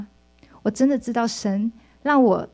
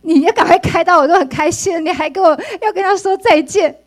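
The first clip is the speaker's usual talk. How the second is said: loud, high exasperated scold; husband loudly scolding his sentimental wife; 6 semitones higher, 7 dB louder than usual. Retold or imitated. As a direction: scold her for clinging to it. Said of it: "我弟兄说".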